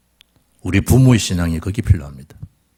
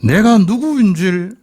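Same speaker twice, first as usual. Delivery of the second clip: impassioned climax; 10 semitones higher, 4 dB louder, slower than usual. Real, not acted. Preacher climaxing on Jesus' words.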